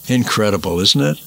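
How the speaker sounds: usual speaking voice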